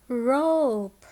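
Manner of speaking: "rope" drawn out slowly; phonics-teacher "rope" with a stretched vowel; long O in rope. Shown as a demonstration. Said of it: "words that have the long sound".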